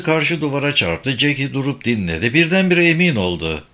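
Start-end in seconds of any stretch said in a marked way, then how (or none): none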